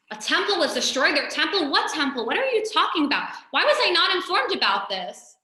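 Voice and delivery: a startled emperor demanding answers, voice shooting up; indignant surprise. Acted. Napoleon hearing of the temple, loud astonished outrage.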